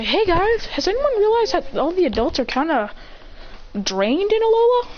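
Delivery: put-on high, excited kid voice; high character voice. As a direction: voice a curious classmate.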